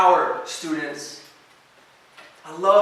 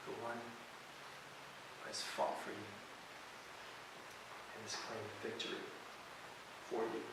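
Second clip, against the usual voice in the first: hushed ministry close; 4 semitones lower, 12 dB quieter than usual. Acted. Quiet closing ministry moment.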